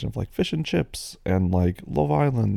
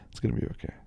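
whisper; 11 dB quieter, much faster than usual. Real, not acted. Whispered reassurance after laugh.

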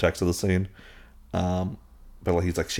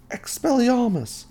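drawn out much higher, slow spell cry; casting a spell; slower than usual. Retold or imitated. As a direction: call the spell out high and slow like a young wizard. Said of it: "Harry gets up and goes".

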